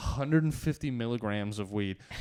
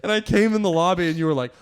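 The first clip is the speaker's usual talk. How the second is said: ordinary talk, nothing marked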